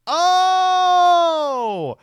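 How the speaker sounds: long, high, loud "ohhh"; mock "oh" of realization. Streamer reacting to an absurd claim, mock revelation. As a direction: draw out a big exaggerated oh.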